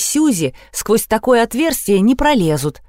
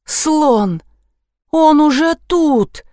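panicked; 7 semitones higher, much slower than usual. A narrator's reading. Narrator panics at elephant.

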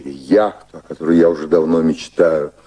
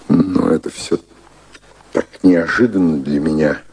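ordinary delivery

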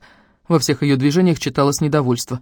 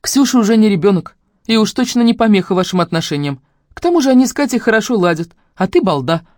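higher, louder brisk arguing; teasing insistence; 7 semitones higher, 4 dB louder than usual. A narrator's reading. Sister pushing brother towards Katya, insistent. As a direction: knock down his excuses.

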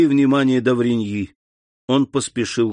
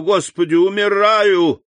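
dying man's cry; 7 semitones higher, 3 dB louder than usual. A narrator's reading. Dying servant cries out to God, high agonized cry. Больной умирает в припадке.

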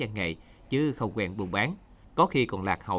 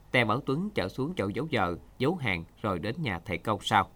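ordinary delivery, nothing marked

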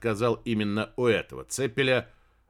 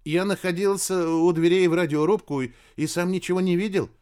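higher, louder subordinate's voice; frightened radio operator; 5 semitones higher, 5 dB louder than usual. A narrator's reading. Radio operator reporting to the admiral, nervous. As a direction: report up high to a superior, nervously. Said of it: "радист отчаянно боится".

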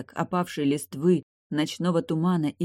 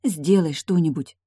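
ordinary delivery, nothing marked

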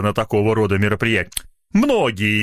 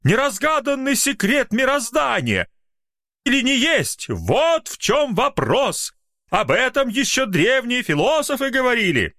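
much higher, rapturous voice; excited writers; 13 semitones higher than usual. Acted. Writers gush over the riddle, much higher. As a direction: gush like awed literati. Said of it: "кричат литераторы".

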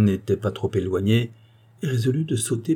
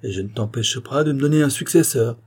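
raised, high-pitched indignation; angry protest; 6 semitones higher, 5 dB louder than usual. A narrator's reading. Visitor striking his stick at the girl's feet, indignant outburst. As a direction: lay down the law, heated. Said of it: "en frappant le sol de son bâton".

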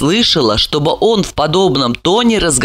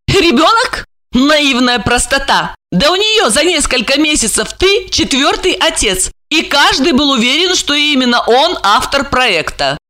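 high-pitched quarrelsome jeer; high, derisive shrew; shrewish woman; 9 semitones higher than usual. A narrator's reading. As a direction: sneer in a high, catty voice. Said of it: "раздался склочный женский голос".

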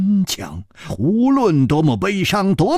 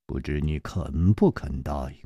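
put-on calm older hero; 13 semitones lower, 7 dB quieter than usual. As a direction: speak low and unhurried, gently reproaching. Chu Liuxiang requests.

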